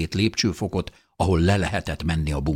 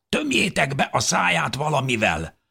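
higher, hoarse demand; hoarse thin woman; 9 semitones higher than usual. A narrator's reading. Thin woman wants the baby gagged, hoarse. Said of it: "mondta reket hangon egy sovány nő".

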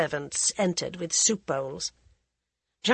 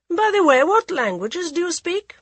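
cool, mocking aristocrat voice; mocking prince; 11 semitones higher, 6 dB louder than usual. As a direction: sneer it like a bored royal. Prince Pratap asks indifferently.

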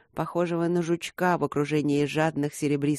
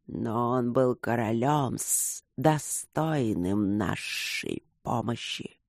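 slow, low rustling wind spirit; 5 semitones lower, much slower than usual. A narrator's reading. Wind spirits answer from the other side, slow and rustling. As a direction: stretch each word out like wind through leaves. Spirits keep arguing with Luke.

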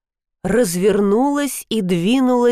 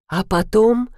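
plain reading voice

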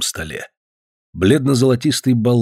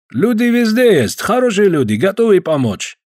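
put-on Caucasian accent; 9 semitones higher than usual. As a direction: stretch each word with a heavy Caucasian accent. Georgian visitor voiced.

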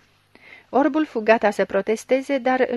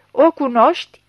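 loud, higher, hammered question; insistent repeat; 4 semitones higher, 8 dB louder than usual. A narrator's reading. Chief repeats question to unresponsive blind man, forceful insistence. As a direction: press harder for an answer. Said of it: "repetă șeful".

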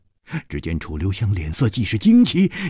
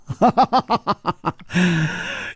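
轻笑; 5 semitones higher than usual. A narrator's reading. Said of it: "楚留香说".

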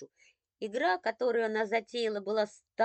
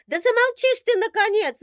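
much higher, much louder scream; furious shout; 15 dB louder than usual. Acted. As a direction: explode at him. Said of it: "вышла из себя Виктоша".

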